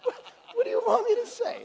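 high, laughing mock exasperation; mocking self-quote. Telling her what he talks about, high mock exasperated squeak. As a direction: squeak it out through laughter like a flustered guy. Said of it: "I'm like".

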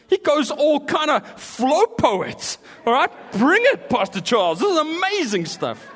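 high, loud shout of hype; excited hype. Real, not acted. Preacher cheers on Pastor Charles, excited shout. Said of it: "cheering Spurgeon on".

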